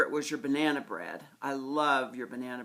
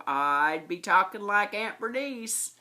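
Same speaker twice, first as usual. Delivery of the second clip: slow, drawn-out Southern Illinois drawl, pitched up; Southern Illinois accent; 5 semitones higher, 4 dB louder than usual. Shown as a demonstration. Niece showing her aunt how she talks like her, Southern Illinois accent. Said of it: "my Southern accent, my Southern Ridgeway or Southern Illinois accent".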